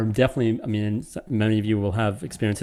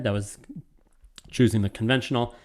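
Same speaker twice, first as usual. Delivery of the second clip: usual speaking voice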